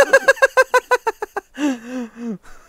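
high loud cackle; shrieking laugh. Real, not acted. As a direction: burst into a screaming laugh. After shouting at friend.

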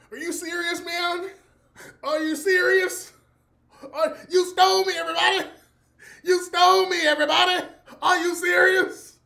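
high, loud indignant yelling; imitating a furious actor yelling at the cast; outraged actor. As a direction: shout your outrage at everybody. Actor outraged, voiced loudly.

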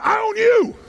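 high, forceful shout; like you mean it. Shown as a demonstration. Speaker shows the audience how to challenge a partner, fierce shout. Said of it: "say it like you mean it".

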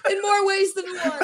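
cracking up mid-line; words squeezed out while laughing hard; laughing while saying it; laughing through words. Real, not acted.

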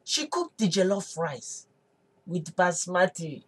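ordinary delivery

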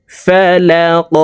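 ফালাক ending in a bounced echoing q; qalqala bounce. Shown as a demonstration. Teacher demonstrating qalqala, drawn out. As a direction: push the final q of ফালাক out. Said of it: "ধাক্কা দিয়ে উচ্চারণ করতে হবে".

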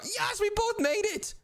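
high, drawn-out victory shout; excited cheer. Pretend. Gamer to Jeffy after both made it, excited cheer. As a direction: whoop it out high, stretching the words. Both got past the officer.

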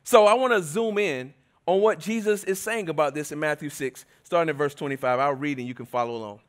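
usual speaking voice

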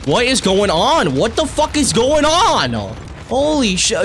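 rising from puzzled to exasperated; incredulous. Real, not acted. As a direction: drag it out in baffled outrage. Disbelief at lobbies.

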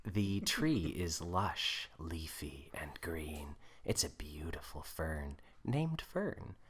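soft, slow, measured narrator; hushed script narration; stage-direction narration. A narrator's reading. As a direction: read the scene quietly and slowly.

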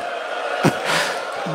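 a breathy, voiceless sob; mother sobbing before the farewell; choked sob. Acted. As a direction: break down into a silent sob.

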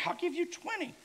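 high-pitched mock kid voice, jokey offer; mock eager kid. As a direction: squeak it like a cheeky kid bargaining. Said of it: "most kids would".

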